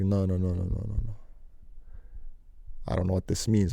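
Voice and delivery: a hushed little panic; panicked. Retold or imitated. Boy seeing private numbers call his phone, hushed worried panic.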